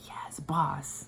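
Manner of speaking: whispered mock-obedient reply; meek obedience. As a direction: answer under your breath, imitating an awed underling. Meek reply to singer's order.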